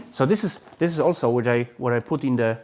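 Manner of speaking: ordinary talk, nothing marked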